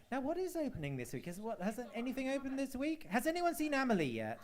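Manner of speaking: high-pitched whine; whiny voice. Pretend. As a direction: put on a high, plaintive whine. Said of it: "That's my whiny voice".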